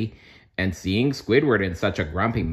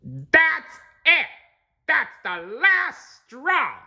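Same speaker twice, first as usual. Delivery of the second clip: very high, drawn-out angry yell; furious shout; much slower than usual. A narrator's reading. Squidward at his window, furious shout. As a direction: explode at the final straw. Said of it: "Squidward shouted".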